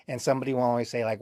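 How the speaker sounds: ordinary talk, nothing marked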